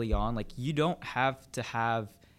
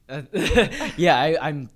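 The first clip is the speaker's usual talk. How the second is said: laughing; 5 semitones higher, 12 dB louder, much slower than usual. Real, not acted. Laughs at forgetting the quote.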